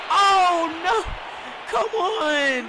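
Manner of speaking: high, loud shout of dismay; shocked outcry. Real, not acted. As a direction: yell as the play goes wrong. Reacting to the play live.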